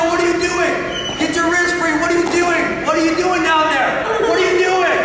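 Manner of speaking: high-pitched, nonstop panicked shouting; frantic sideline parent. Acted. Wrestling parent shouting from the corner at their kid, freaking out. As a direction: yell frantically as if losing all composure. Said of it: "I see parents go nuts in the corner".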